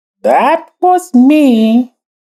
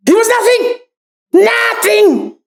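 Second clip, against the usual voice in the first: shouting; 7 semitones higher than usual. Acted. Boasting that Gaston is nothing.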